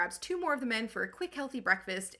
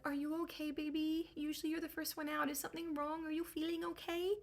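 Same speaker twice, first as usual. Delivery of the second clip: higher, softer cooing to a pet; tender baby talk; 7 semitones higher, 5 dB quieter than usual. Acted. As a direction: coo gently at a beloved sheep. Cyclops coos to favorite sheep.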